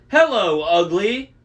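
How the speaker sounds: loud, high jeering call; taunting shout. A narrator's reading. Annabeth taunts the Cyclops, shouting. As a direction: yell it from far off to bait him. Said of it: "Annabeth shouted".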